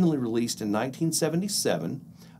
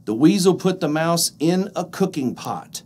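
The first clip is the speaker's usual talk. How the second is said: ordinary delivery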